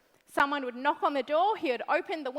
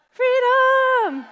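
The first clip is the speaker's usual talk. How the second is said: loud, high cry of freedom; rallying shout; 10 semitones higher, 8 dB louder than usual. Real, not acted.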